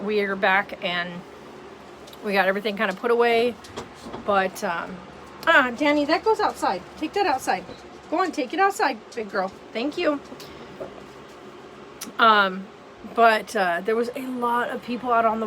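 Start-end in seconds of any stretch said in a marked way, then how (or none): 5.5-10.2 talking to the dog. Real, not acted.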